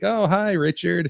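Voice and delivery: high, slow, sing-song hello; chirpy greeting. Retold or imitated. Mom greeting the head-injured patient, high sing-song voice. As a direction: greet him sweetly, a put-on voice. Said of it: "and I'd be like".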